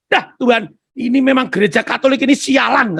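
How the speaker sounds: a hint of bitter muttering to God; angry inner grumble. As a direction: vent it like a man stewing inside. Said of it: "katanya gitu ya".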